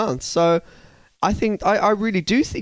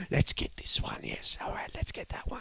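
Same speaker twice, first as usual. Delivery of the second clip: whisper; 3 semitones higher, 11 dB quieter than usual. Retold or imitated. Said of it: "It's like".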